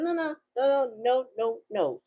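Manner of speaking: rapid high no-no-no, dropping at the end; playful high-pitched protest; urgent mock objection. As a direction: halt someone grabbing too soon. Halting the person grabbing the box.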